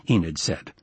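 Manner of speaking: plain reading voice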